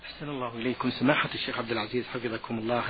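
ordinary talk

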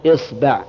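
إصبع as "isba'", i first, a after ba; kasra then fatha. Shown as a demonstration. Teacher starting the kasra set, إصبع as "isba'". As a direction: say إصبع with opening i and second vowel a. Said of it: "كسر الهمزة".

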